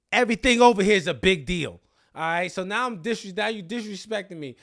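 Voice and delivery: loud, high-pitched indignant outburst; mock outraged shout. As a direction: blow up in hammed-up offence. Retold outburst at an insult.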